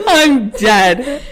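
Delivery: gasping through laughter; laughing. Real, not acted. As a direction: wheeze it out laughing. Cracking up.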